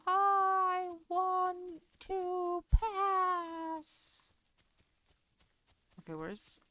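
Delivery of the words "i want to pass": whiny plea. Pretend. Pleading with the game for a door.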